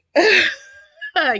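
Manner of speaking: sudden loud, high laugh; loud laugh breaking the tearful mood; real self-conscious laugh. Real, not acted. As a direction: burst out laughing at yourself. Laughs at herself over crying.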